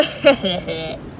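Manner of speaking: low evil chuckle; low menacing chuckle; evil laugh. Acted. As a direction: chuckle darkly. Villain laughs.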